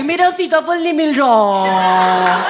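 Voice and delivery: put-on high, slow whining girl voice; whiny third roommate. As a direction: drag it out like a sulky roommate who lost her fruit. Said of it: "उनकी तीसरी roommate आ गई". (ऊँची, धीमी, रोनी सी roommate की नकल)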